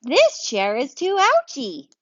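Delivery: a bear wincing on a hard chair; slow whiny bear, up high. Acted. Bear voiced in read-aloud story.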